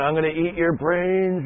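mock-menacing zombie chasing a toddler; a hint of a movie zombie; playful zombie. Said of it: "I pretend like I'm a zombie".

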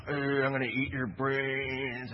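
put-on slow, drawn-out zombie groan to a giggling kid; playful zombie. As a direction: lumber the words out like a play zombie. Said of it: "I pretend like I'm a zombie".

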